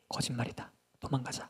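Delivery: hushed alarm among accomplices; very soft secretive whisper; furtive whisper. Acted. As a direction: whisper it to slip away unnoticed. Hidden Philistines plan to flee.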